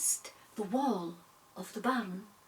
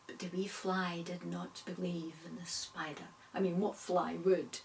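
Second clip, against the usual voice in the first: usual speaking voice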